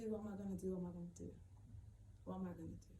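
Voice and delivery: fading, softly repeated worry; quiet despair. Retold or imitated. Homeless parent alone with the worry, quiet despair. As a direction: let the question sink to a murmur.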